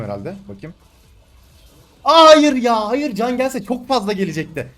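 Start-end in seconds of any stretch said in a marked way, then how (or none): 2.0-4.7 dismayed shout. Real, not acted. Just died in game.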